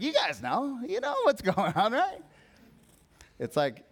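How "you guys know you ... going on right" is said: high-pitched playful assurance; breezy confidence. Retold or imitated. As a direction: act out an upbeat "you already know". Jesus retold as casually sure.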